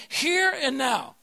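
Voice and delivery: slow, drawn-out "Here and now"; call and response. Shown as a demonstration. Preacher leads the church in a slow call-and-response. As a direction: stretch each word for the church to echo. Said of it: "Everybody say".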